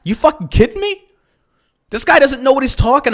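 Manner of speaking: high disbelieving outburst; high incredulous snap. Real, not acted.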